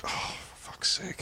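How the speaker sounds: whispered sigh of resignation; exasperated whisper. Retold or imitated. Man retelling his reaction to his wife, exasperated whisper. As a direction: breathe it out, barely voiced.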